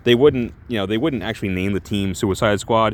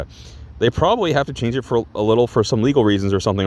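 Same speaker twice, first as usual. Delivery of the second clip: ordinary delivery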